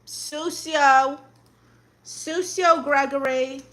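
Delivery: usual speaking voice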